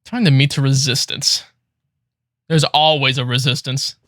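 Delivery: usual speaking voice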